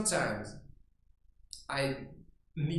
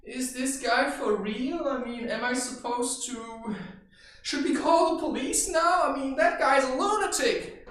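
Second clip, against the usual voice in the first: higher, louder alarmed disbelief; alarmed onlookers; 8 semitones higher, 5 dB louder than usual. Retold or imitated. Adults watching him, louder and higher in alarm. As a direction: sound like a scandalized bystander. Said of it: "these adults would stand there and think".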